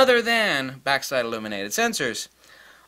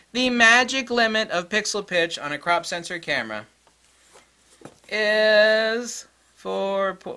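raised pitch, drawn out for emphasis; really slowly; 6 semitones higher, slower than usual. Shown as a demonstration. Teacher repeating an important point, really slowly. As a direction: stretch it out so it sinks in. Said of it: "Let's repeat that really slowly".

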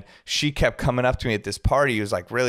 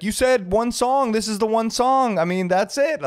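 imitating the client; 11 semitones higher than usual. Client to security, high smug imitation. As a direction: mimic the client gloating over his one song. Client voiced insisting one song.

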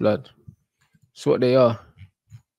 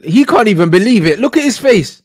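loud, rising, higher excited yelling; excited disbelief; 8 semitones higher, 8 dB louder than usual. Real, not acted. Pointing at the keeper's face, loud excited yelling. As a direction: build it up in amazement.

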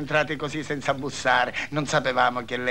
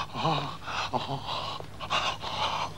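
breathy whisper; 9 dB quieter, much slower than usual. Real, not acted. Sighing in the heat.

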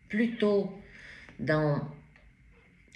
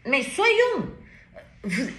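ordinary talk

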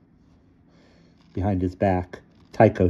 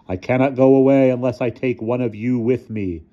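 lightly growled, raised ominous voice; a measured threat from a spectre; menacing growl; 5 semitones higher, 4 dB louder than usual. A narrator's reading. Figure threatens to take a child, mild growl. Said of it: "he growled".

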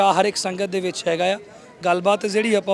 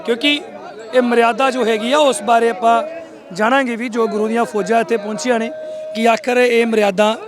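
louder on-camera news report opening; field reporter intro; 3 semitones higher, 5 dB louder than usual. Acted. Reporter opens the live festival report to viewers, louder report voice.

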